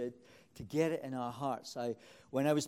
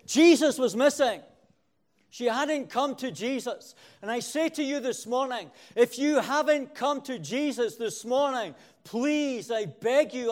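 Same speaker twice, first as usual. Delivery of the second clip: louder, higher, driving sermon delivery; preaching at full force; 6 semitones higher, 9 dB louder than usual. Real, not acted. Preacher pleading with his congregation to come to Jesus, fervent and loud. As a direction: pound each phrase home to the church.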